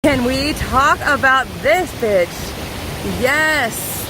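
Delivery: loud, slow, amazed; awed excitement. Real, not acted. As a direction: gush over the view in awe. Awed at waterfall.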